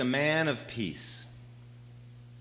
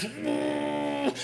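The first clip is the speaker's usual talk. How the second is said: high, drawn-out cry; John's cry; 13 semitones higher, 4 dB quieter than usual. Retold or imitated. John cries out as the plane drops, high and drawn out. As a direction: give a long, high exclamation.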